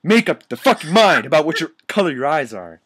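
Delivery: fast, loud, high complaint; real exasperation. Real, not acted. Reader scolding the author over eye colour, exasperated. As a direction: blurt it out, fed up. Reader annoyed by the story's inconsistency.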